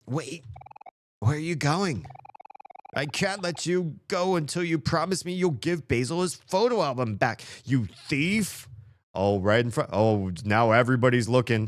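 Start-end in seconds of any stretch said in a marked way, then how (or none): 0.1-8.7 higher, accusing character voice; mildly voiced accusation. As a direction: stop the thief with a raised voice. Voicing character stopping thief.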